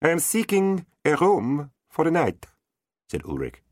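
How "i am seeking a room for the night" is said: gentle knight. Acted. Knight answers the tavern.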